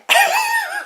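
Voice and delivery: short high-pitched giggle. Real, not acted. Laughing at the surprise truck, high giggle.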